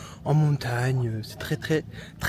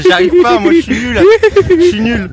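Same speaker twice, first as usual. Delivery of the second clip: loud; 22 dB louder than usual. Real, not acted. Failing the cartwheel, laughing.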